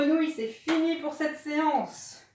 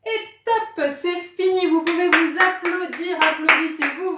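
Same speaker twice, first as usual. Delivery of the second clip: higher and louder, cheering the class on; excited cheering; 6 semitones higher, 7 dB louder than usual. Real, not acted. Coach cheering the class at the end of cardio, excited and loud. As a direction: celebrate the finish, call it out bright and loud.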